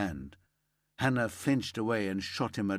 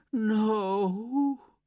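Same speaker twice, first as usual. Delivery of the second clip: high, loud, breaking wail; anguished wail; 13 semitones higher, 5 dB louder than usual. Acted. Mother hearing her daughter was killed, wailing. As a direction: cry it out as the news lands. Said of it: "Hannah wailed".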